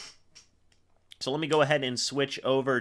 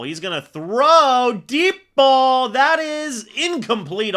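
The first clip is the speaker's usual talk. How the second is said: high, loud, drawn-out call; real excited sportscaster shout; excited play call; 10 semitones higher, 8 dB louder, slower than usual. Real, not acted. Live call of a deep throw.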